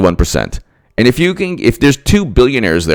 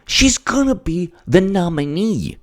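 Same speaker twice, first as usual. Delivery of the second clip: high put-on voice of smug pundits; mock pundit voice; 10 semitones higher than usual. Mocking what people say about Clinton, in a high put-on voice. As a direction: sneer it like a know-it-all commentator. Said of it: "people are like".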